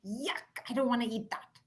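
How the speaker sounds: lightly voiced five-year-old refusing dinner; a hint of a disgusted little kid balking at food; imitated fussy child. Daughter looking at the food at the table, mildly disgusted. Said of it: "and she goes".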